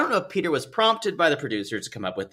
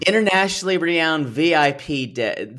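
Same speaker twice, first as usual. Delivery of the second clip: ordinary talk, nothing marked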